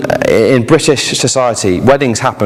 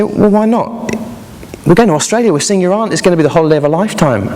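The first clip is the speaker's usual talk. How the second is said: higher, coaxing parent voice; 5 semitones higher than usual. A narrator's reading. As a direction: coax her cheerfully.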